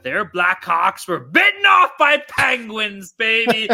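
slow, loud punchline yell; slow, loud comic yell about penguins; hammed-up shout. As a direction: bellow the punchline slowly. Comic punchline at mock bit.

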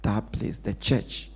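soft, slow and low, almost confiding; quiet intimate preaching; hushed emphasis. Real, not acted. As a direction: drop to a near hush to let it sink in. Preacher hushes on key point.

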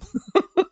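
a short high laugh; amused laugh. Real, not acted. Dad laughing at the comeback, an amused laugh. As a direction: laugh at the zinger.

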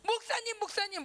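put-on high, urgent old woman's call; imitated high-pitched interrupting call; calling from the back. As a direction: call out to the pastor over his preaching. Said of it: "어떤 할머니가 저 뒤에서".

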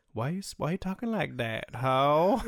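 hammed-up sass, loud final word; sassy inner monologue. The unspoken reply to someone rude, played sassy. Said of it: "That's the inner monologue".

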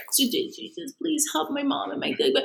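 put-on high, soft little-kid prayer; praying child. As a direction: imitate a small boy praying earnestly. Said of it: "would pray".

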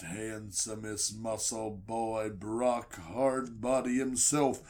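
low, slow, booming announcer voice; deep, slow announcer introduction; ring announcer. Acted. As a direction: draw out the intro like a wrestling announcer. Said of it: "you hear it".

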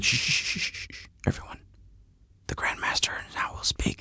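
mock-solemn whisper calling the room to silence; hushed whisper. Whispering to hush a room before the master speaks. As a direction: whisper it like a pompous herald.

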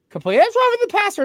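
squeaky high mocking ref voice; mock referee call. Fan mocking the referee's ruling in a squeaky high voice. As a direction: parody the ref's call in a silly high voice.

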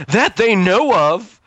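high, drawn-out retort through a laugh; ominous head voice. Acted. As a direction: stretch it out high, as a paranoid inner voice. Second head voice undercuts the reassurance.

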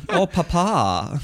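high, slow swooning sigh with a laugh; swooning Papa. Pretend. A partner swooning over Papa, high and slow. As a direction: moan it like a smitten lover. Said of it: "I could do that".

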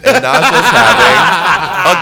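laughing. Real, not acted. Laughing punchline, drawn out.

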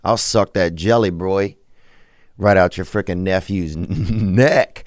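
usual speaking voice

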